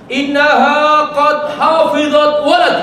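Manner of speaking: slow, loud, high chanted Arabic; preacher recites Umar's words to the congregation; Arabic recitation. Acted. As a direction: intone each word slowly and with weight. Quoting Arabic in sermon.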